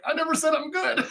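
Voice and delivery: high, soft words cracking into laughter; laughing retort. Retold or imitated. He answers Vic while pinned, high and laughing. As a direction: giggle out the comeback. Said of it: "I'm like".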